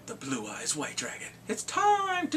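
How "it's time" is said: hammed-up duel call. Dramatic game announcement.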